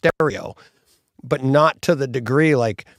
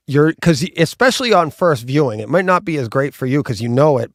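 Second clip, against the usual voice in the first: normal talking voice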